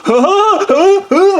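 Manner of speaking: high-pitched nervous giggle; shrill manic titter. Retold or imitated. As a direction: giggle like a lunatic. Character's laugh after panic.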